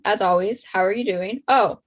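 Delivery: ordinary delivery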